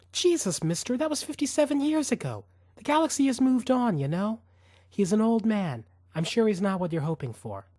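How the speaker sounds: put-on weary woman waving off old history; exasperated tired mother. Celeste voiced by narrator.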